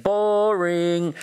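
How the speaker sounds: long drawn-out, high jeer; sustained high-pitched heckle; imitated heckling crowd. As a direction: call it out like a bored crowd heckling. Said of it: "they start saying".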